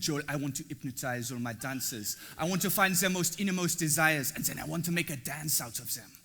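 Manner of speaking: lightly put-on French accent, barely shifted; a mock French accent, half-hearted; bad French accent. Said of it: "I'm gonna do my very bad French, uh, a-, accent impression now".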